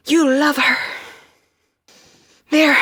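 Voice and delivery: high, loud insistent cry; urgent shout. Acted. Urging Sam to reach her, loud insistent cry. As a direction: push it out as a desperate plea.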